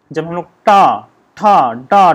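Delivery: टा, ठा, डा said loud and separate; teacher demonstrating टा ठा डा; sounding out retroflex consonants. Shown as a demonstration. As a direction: hit each syllable cleanly for the class. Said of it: "आप लोग बोल के देखिए".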